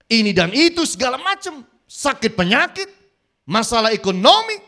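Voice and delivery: rising, loud sermon climax; fervent preacher pounding out a list of troubles; preaching at full force. Acted. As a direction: build it up from the pulpit. Preacher listing burdens.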